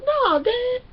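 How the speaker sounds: put-on high-pitched little-girl whine to Dad; imitating a daughter fibbing to her father; mock daughter voice. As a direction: put on a high, innocent kid voice. Voicing the daughter answering her dad.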